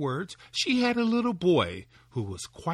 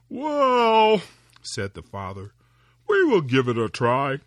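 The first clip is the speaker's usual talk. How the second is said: louder, amused disbelief; laughing doubtful father; 8 dB louder than usual. Acted. Father doubting his tiny son, louder and amused. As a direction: chuckle at the boy's idea.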